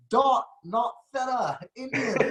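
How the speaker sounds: slow, high Trinidadian lilt spelling it out; Trinidadian accent. Retold or imitated. Quoting Adam's slow explanation in a high Trinidadian accent. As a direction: stretch the phrase out in his accent. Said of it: "He said".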